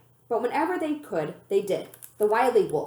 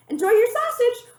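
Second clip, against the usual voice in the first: much higher, loud taunting shout; 13 semitones higher, 7 dB louder than usual. A narrator's reading. Raina taunting the woman while fleeing, loud shout. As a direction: fling it back over your shoulder. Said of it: "Raina shouted".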